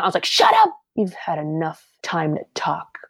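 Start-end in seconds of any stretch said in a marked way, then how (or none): 0.2-0.7 very high, sharp retold shut-down; angry cut-off. Retells cutting off the bully. 1.0-3.0 slow, softer, deliberate scolding; retold warning, slowed right down; stern put-down. Retells cutting off the bully.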